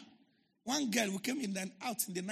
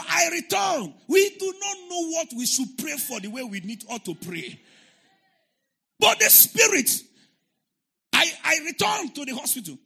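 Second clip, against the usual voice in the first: loud, higher preacher's shout; fiery preaching; 8 semitones higher, 10 dB louder than usual. Acted. Pastor preaching loudly to his congregation, fiery shout. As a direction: preach it out with full force.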